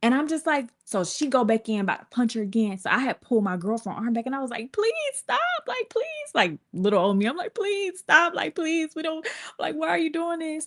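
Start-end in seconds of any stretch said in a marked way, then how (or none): 4.7-6.0 small, high-pitched begging; retold pleading. Teller begs girlfriend to stop punching, high plea. 7.5-10.7 high little begging voice; retold pleading. Teller begs girlfriend to stop, meek high plea.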